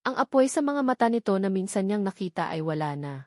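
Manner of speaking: plain reading voice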